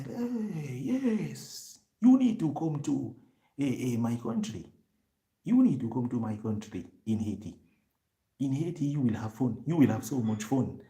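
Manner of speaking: high, eager voice that settles down; a lightly voiced proud Haitian friend; imitating the Haitian friend. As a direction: play the friend bragging about Haiti.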